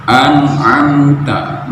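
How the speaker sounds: an'amta with a throaty ain; slow an'amta, ain from the throat. Shown as a demonstration. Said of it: "nggak boleh keliru". (an'amta dengan huruf ain)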